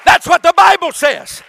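sermon crescendo into a shout; preaching. Acted. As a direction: build the Acts story to a full-voiced peak. Preacher building to climax.

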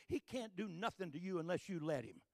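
ordinary delivery

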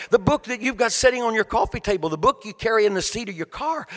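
normal talking voice

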